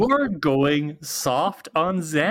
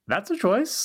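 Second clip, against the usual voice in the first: pitched up high, a wry mock-polite judgment; dry sarcasm; 8 semitones higher than usual. Real, not acted. Host reacting to the episode's cold open, sarcastic and high. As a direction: arch the voice up high like a raised eyebrow.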